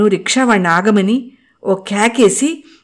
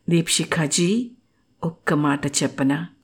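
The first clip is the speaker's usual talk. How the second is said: softer, tender and hushed; rapt; 3 semitones lower, 7 dB quieter than usual. Acted. Mukul speaking to Deepa, entranced soft voice. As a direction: lean in softly, entranced. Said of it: "తన్మయత్వంతో".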